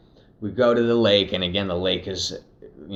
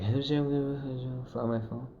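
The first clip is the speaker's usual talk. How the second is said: imitated distant garbled mumbling, high and soft; mock incoherent mumble, pitched up; unintelligible mumble; 6 semitones higher, 6 dB quieter than usual. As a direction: slur the words into mush, like a stranger heard from far off. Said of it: "What the fuck did that dude say".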